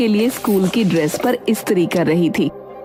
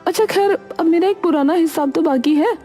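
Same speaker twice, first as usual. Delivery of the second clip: pointed reminder; 9 semitones higher than usual. Acted. Friend brings up an old debt.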